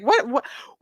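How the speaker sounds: mock-shocked exclamations; imitating shock. Host imitates the shocked judge for viewers, mock shock. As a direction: act out someone taken aback. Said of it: "the judge was so shocked".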